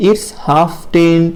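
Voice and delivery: very slow, word by word it's half past ten; teacher drilling it's half past ten slowly for the class; slow model phrase. Shown as a demonstration. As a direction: spell it out for young pupils. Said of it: "কি বলবো?".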